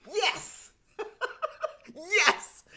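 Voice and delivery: high joyful cheer with laugh. Real, not acted. Elated cheering.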